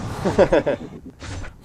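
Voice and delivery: short string of chuckles; self-amused laugh. Real, not acted. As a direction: laugh at your own crankiness. Laughs at own crankiness.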